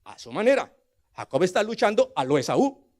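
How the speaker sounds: normal talking voice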